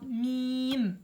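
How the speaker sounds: mim held long and loud in Quran recitation; recited mim. Shown as a demonstration. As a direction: sing out mim, drawing the nasal out. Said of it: "j'ai bien prononcé m".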